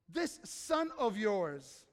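very slow, drawn-out, scornful this; judgmental scorn. Retold or imitated. Preacher voicing the older son's scorn, slow and contemptuous. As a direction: drag it out with contempt. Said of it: "the spirit of judgmentalism".

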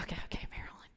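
breathy whisper of giving in; whispered give-in. Retold or imitated. To her demanding friend, a resigned whisper.

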